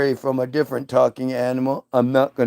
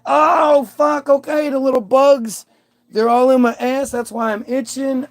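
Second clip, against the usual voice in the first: sudden-wise Adam; 10 semitones higher than usual. Acted. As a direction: blurt it out as it all clicks. Adam's realization after bite.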